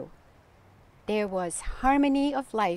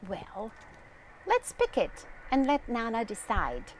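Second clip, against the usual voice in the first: plain reading voice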